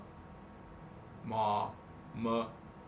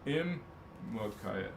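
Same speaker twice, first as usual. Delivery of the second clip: ordinary talk, nothing marked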